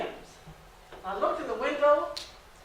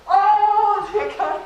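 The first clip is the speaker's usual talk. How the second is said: a loud burst of relief; joyful outcry; 7 semitones higher, 9 dB louder, much slower than usual. Acted. Mother hearing her son is coming home, loud joyful cry. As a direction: cry out with sudden joy.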